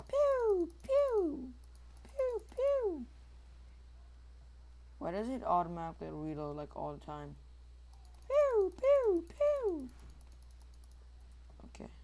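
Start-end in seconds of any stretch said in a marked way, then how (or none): 0.1-3.1 high falling zap noises; mimicked toy-blaster shots; playful laser pews. Player shooting in a game, high pew noises. 8.3-9.9 loud, high mimicked zaps; three falling blaster noises; playful laser pews. Player shooting in a game, loud high pew noises. Imitating laser gun shots.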